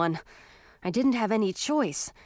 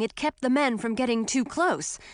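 usual speaking voice

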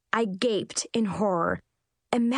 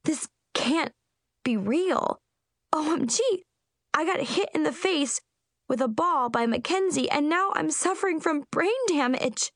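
hysterical; 8 semitones higher than usual. Acted. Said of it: "I shrieked hysterically".

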